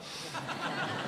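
quick airy laugh at his own story; a short airy chuckle; soft breathy laugh. Real, not acted.

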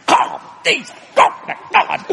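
strained, breathy cursing in pain; pained groaning. Retold or imitated. Man busting knuckles on a cold pipe, pained groaning. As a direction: groan it through the hurt.

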